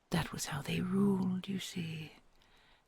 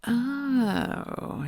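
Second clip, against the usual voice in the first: surprised; 7 semitones higher, 8 dB louder than usual. Acted. Sudden delighted exclamation.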